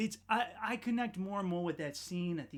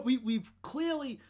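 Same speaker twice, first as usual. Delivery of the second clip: normal talking voice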